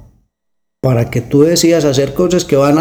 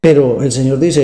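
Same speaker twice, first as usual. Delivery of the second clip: ordinary talk, nothing marked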